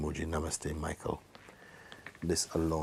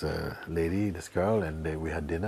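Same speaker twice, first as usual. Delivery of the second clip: normal talking voice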